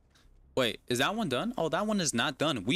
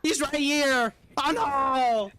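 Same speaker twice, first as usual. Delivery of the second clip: panicked; 12 semitones higher than usual. Real, not acted. Streamer being chased by the killer, panicked yelling. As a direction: scream it while running for your life.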